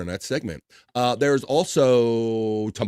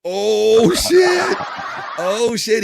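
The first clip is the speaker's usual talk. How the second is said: excited; 10 semitones higher, 6 dB louder, much slower than usual. Real, not acted. Reacting to promo.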